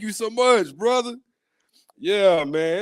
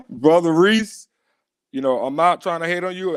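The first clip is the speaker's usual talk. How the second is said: ordinary talk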